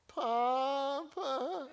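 put-on high, drawn-out whiny little girl; high nasal toddler whine of Papa. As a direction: whine it like a tired three-year-old. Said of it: "I just hate that voice".

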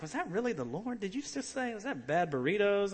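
high, comic self-doubt; doubtful questioning. Retold or imitated. Acting out doubting what he heard.

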